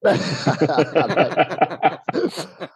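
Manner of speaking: long high giggling laugh; real high laugh. Real, not acted. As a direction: crack up at the joke. Laughing at gardener joke.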